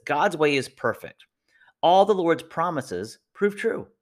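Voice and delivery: slow, lifted reading of the verse; scripture reading. Shown as a demonstration. Pastor reading a verse to listeners, slow and solemn. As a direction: reads the verse slowly and with weight. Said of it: "It says this".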